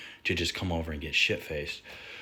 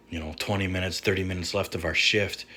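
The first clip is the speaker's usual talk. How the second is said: ordinary delivery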